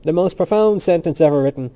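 loud, keen proclamation; enthusiastic. A narrator's reading. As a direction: announce it with open enthusiasm. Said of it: "Temple said with enthusiasm".

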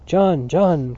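loud, drawn appeal by name; urgent cry. A narrator's reading. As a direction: call out to restrain a friend. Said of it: "cried Mister Dedalus".

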